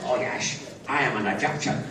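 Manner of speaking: low, slushy Scottish film-star voice; Sean Connery. Retold or imitated. Film star claiming to be Egyptian, deep Scottish impression. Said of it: "Sean Connery comes on and I said".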